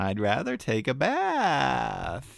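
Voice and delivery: slow, drawn-out sulky whine; whiny child voice. Pretend. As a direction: pout it out like a child. Keeps whining, avoiding dog walk.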